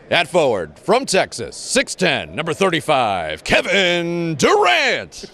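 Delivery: booming PA announcer, long drawn-out name; echoing stadium announcer. Shown as a demonstration. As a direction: introduce the starter to a roaring arena. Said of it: "Give me something in your P A voice".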